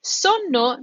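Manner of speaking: SONNO, short first vowel, long N; double N. Shown as a demonstration. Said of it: "you said two N's".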